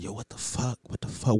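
near-whispered mock dismay; retold hushed dismay. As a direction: curse under your breath, as if she might hear. Retold private panic, stuck with guest.

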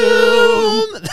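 loud, very high held note; over-the-top cry on one long word; drawn-out mock bellow. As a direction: blare it out and stretch it. Comic booming end of the bit.

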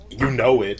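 a hint of a Jersey accent. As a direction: lightly toss it off with Jersey attitude. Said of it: "from Jersey?".

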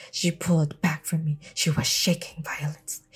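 hushed tense reading; a dramatic whisper. Real, not acted.

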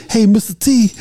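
high, loud student greeting; students calling out. Retold or imitated. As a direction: call out like a cheeky student.

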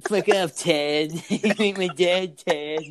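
slow, dragged-out mock Austrian snap; lazy, hammed-up Arnold brush-off; bad Schwarzenegger voice, stretched out; Arnold impression. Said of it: "your impression of Arnold's".

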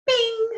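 high, loud ringing "bing"; bright "bing" chime. Shown as a demonstration. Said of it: "the sound that makes dreams come true".

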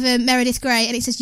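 normal talking voice

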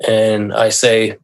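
normal talking voice

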